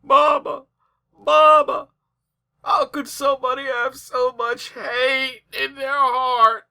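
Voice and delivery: put-on high, whiny little-kid voice, slowed; mock child's voice. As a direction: parody the child pleading with her mama. Mocking the child in the clip.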